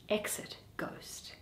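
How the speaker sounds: soft far-off aside; whispered direction. Acted. Reader whispers the stage direction as the ghost leaves. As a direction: breathe out "Exit ghost".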